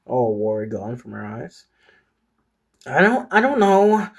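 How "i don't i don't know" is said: flustered denial. Acted. Peyton answers under questioning.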